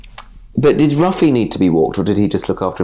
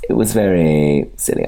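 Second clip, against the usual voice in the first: ordinary talk, nothing marked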